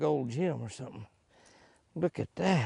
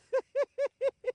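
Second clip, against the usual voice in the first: laugh; 3 dB louder than usual. Real, not acted. Laughing at himself.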